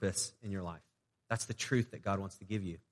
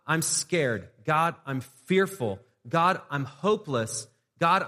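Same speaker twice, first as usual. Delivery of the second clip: honest prayer; 5 semitones higher, 5 dB louder than usual. Shown as a demonstration. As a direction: pray it like you mean every word, no polish. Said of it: "one of the most powerful prayers you could pray".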